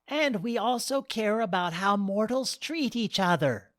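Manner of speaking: lightly voiced wise goddess; gentle goddess voice.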